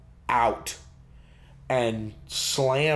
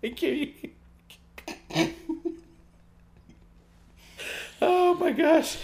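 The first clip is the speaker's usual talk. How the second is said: real high, squeaky laughing fit; helpless laughter; slower than usual. Real, not acted. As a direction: gasp out words while cracking up. Helpless laughter at the accent bit.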